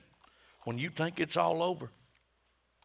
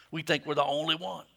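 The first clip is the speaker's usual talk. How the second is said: ordinary delivery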